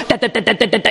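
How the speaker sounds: imitated machine-gun rattle, quick and light; light mouth-made gun noise; mock gunfire. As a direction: rattle off gunshots with a half laugh.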